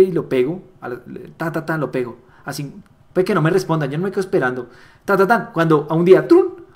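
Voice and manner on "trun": mock notification sound. Imitating a message arriving.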